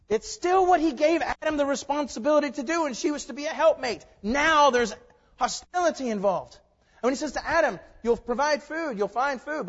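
high, loud, insistent sermon; full-force preaching. Real, not acted. Preacher explains hostility after the fall, high and forceful. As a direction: drive the point home loudly from the pulpit.

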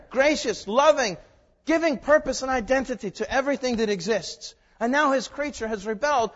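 loud, high, ringing sermon delivery; full-force preaching. Real, not acted. Preacher declares God's coming judgment to the congregation, forceful preaching.

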